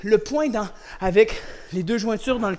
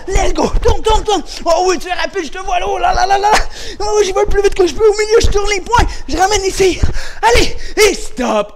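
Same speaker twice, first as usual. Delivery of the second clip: very high, loud cheering-on; frantic high-pitched coach's shout; excited race shouting; 11 semitones higher, 11 dB louder than usual. Real, not acted. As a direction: yell them through a sprint at full volume. Urging on the punching race.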